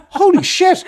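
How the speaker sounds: high, loud laughing mock shock; amazed. Man complimented by a stranger, high delighted shock. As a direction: gasp it out delighted. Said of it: "I'd be like".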